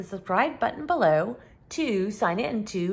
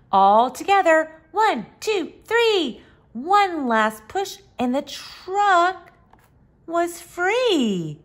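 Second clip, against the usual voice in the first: excited count; 7 semitones higher, 4 dB louder than usual. A narrator's reading. Counting the big push.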